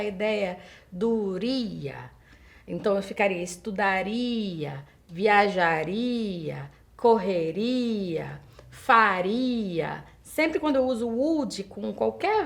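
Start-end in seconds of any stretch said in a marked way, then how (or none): 2.7-10.0 teacher drawing out every ria ending; drawn-out ria endings. Shown as a demonstration. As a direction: stretch the ria in ficaria, estudaria, viajaria, correria, faria, very slowly. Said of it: "eu dou essa entonação".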